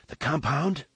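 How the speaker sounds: breathless, startled high question; gasped. A narrator's reading. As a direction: gasp it out in relief and shock. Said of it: "Jerem gasped".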